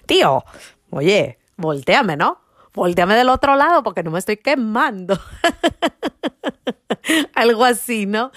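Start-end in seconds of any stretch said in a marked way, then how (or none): 0.1-5.2 put-on jokey Castilian accent, louder and lifted; hammed-up Spain Spanish accent. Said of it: "hablando con ese acento español". 5.2-7.3 laughter. Real, not acted. Laughing at her own impression.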